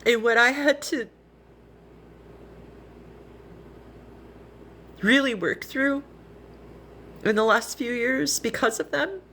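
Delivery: real choked-up apology, pitched high; getting emotional. Real, not acted. Streamer apologizing to followers for what they went through, emotional. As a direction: voice rising as tears threaten, halting with long pauses. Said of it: "I'm gonna get emotional".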